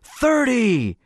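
sung out high with pleasure; joyful shout. A narrator's reading. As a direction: ring it out in triumph. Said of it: "he sang out, with a flare of pleasure".

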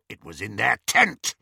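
rasping werewolf. A narrator's reading. Greyback answering Bellatrix's questioning, rasping. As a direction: rasp it out. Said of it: "rasped Greyback".

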